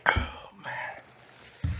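hushed whisper. Real, not acted. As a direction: whisper it, worn out. Quiet aftermath of spicy wings.

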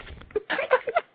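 real trailing chuckle, mostly voiceless; laugh. Real, not acted. Interviewer amused by the guest's church anecdote, chuckling. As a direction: keep chuckling after the punchline. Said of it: "I'm sorry for laughing".

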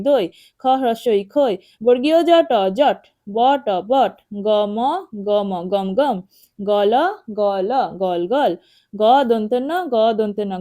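rhythmic letter chant, blending into words like জট, গম, খক; spelling drill. Shown as a demonstration. Teacher leading children in reading, spelling drill. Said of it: "এভাবে পড়তে হবে".

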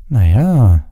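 drawn-out, doubtful answer; reluctant, lingering hesitation; slow, hesitant. A narrator's reading. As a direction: stall with a long, swooping reply. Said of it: "sagte Pavati langsam".

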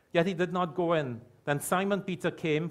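normal talking voice

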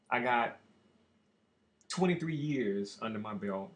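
ordinary talk

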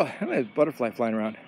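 ordinary talk

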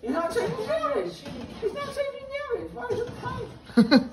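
high giggling disbelief; loopy laughing squeal; after wisdom teeth. Real, not acted.

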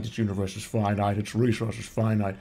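low brooding villain monologue; Thanos voice. Retold or imitated. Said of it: "She came down while I was going like".

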